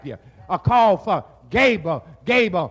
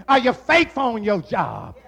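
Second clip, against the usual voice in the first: ordinary talk, nothing marked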